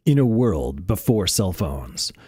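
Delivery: slow, hushed movie-trailer narrator; slow whispery trailer voice-over. Pretend. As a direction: intone it like a film trailer opening. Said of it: "Do it in a movie voice".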